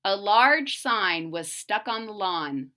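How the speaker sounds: plain reading voice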